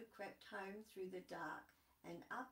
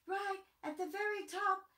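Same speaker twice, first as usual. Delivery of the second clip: rising louder and higher to a cheer; building excitement; 8 semitones higher, 11 dB louder than usual. Acted. Storyteller building the climb to a loud hooray.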